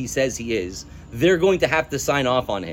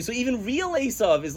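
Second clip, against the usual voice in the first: normal talking voice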